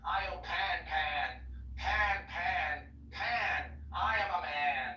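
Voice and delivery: ritual hymn chanted out with surging emphasis on the refrain; incantatory poem recital. Acted. Reciting a hymn to Pan for friends, fervent incantation. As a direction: declaim it like an invocation, building on each cry to Pan.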